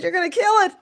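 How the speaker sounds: high, urgent warning cry; retold alarm. Repeats her cry to the cat.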